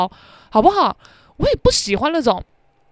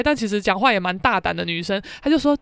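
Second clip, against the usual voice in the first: ordinary delivery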